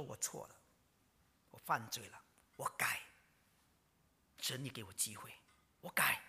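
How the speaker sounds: hushed, whispered repentance; humble confession. Retold or imitated. Josiah confessing to God, softly whispered and humble. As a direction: whisper it with head bowed. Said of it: "叫做自卑".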